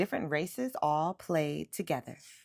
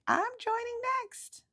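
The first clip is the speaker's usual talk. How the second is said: put-on little boy, eager. A narrator's reading. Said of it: "Martin said".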